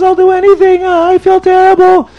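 high, loud mock wail; crying penitent. Sinner pleads with the rabbi, hammed-up crying. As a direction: wail like a desperate, guilt-ridden man. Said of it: "the Yid is crying even more".

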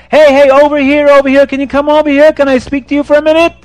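high, loud call across the road; loud urgent hailing; real loud. Acted. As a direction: shout to a traveler to come over. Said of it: "And he says real loud".

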